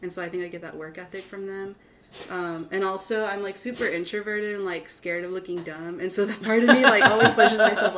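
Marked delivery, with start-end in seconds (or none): 6.0-8.0 much louder, laughing while confessing. Real, not acted. Guest laughing while admitting she fears looking dumb. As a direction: laugh it out, a bit embarrassed.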